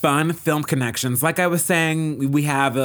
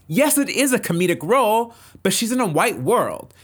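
emphatic retort; 7 semitones higher than usual. Real, not acted.

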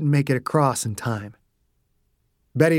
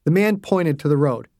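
usual speaking voice

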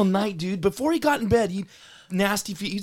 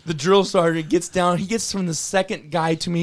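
normal talking voice

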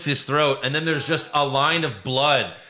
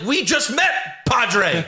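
high-pitched mock protest to Padre; mock outcry; 9 semitones higher, slower than usual. Mocking a rushed father and son scene, high mock outcry. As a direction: pitch it way up, hammed-up.

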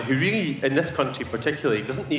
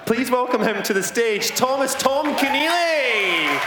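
rising, much higher and louder, stretching the name out slowly; announcer introduction; 11 semitones higher, 3 dB louder than usual. Real, not acted. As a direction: build up and call the guest's name out like a compère. Host introducing guest on stage.

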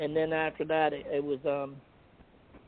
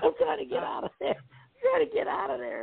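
much higher, fretful mock squeak; squeaky panicked voice. Telling how he fled the ringside seats, high panicked squeak. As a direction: squeak it out like a cornered kid. Fleeing the arena embarrassed.

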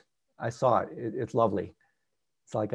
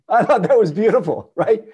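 louder, higher, laughing through the words; laughing delight; 9 semitones higher, 9 dB louder than usual. Real, not acted. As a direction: break into a delighted laugh as you say it. Delighted after telling the story.

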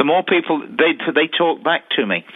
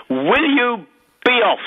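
higher mock shout, telling him off; retold shout; 8 semitones higher than usual. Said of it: "I sit there and I shout at him".

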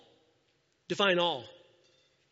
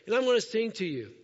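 eager worshipper; 5 semitones higher, 4 dB louder than usual. Retold or imitated. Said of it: "Action of commitment".

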